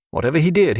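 ordinary delivery

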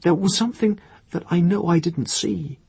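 high, halting, faltering account; groping for words. A narrator's reading. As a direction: stumble and restart as Irene, pitched high. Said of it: "She tried to get the right words".

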